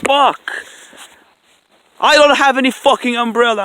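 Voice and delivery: a high, loud curse shouted at the rain; swearing out loud, genuinely fed up; real angry outburst. Real, not acted. Vlogger caught in rain without an umbrella, real angry shout. Rain soaking his camera.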